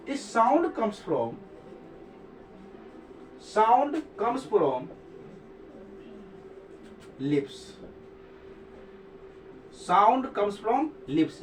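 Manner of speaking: very slow, word by word with long pauses; slow dictation. Shown as a demonstration. Teacher dictating the rule to students, very slow and deliberate. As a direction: dictate it so students can write it down. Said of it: "teacher dictating the rule slowly".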